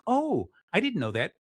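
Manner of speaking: suddenly interested, half-played; mock surprise. Finally hearing something interesting, mock surprised. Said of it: "I'm like".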